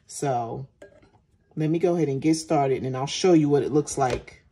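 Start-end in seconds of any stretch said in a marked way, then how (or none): none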